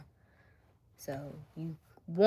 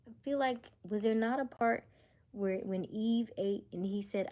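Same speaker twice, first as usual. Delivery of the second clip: ordinary talk, nothing marked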